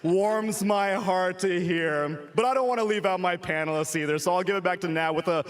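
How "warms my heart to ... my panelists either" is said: ordinary delivery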